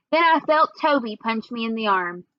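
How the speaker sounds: ordinary delivery, nothing marked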